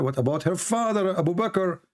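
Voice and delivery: ordinary talk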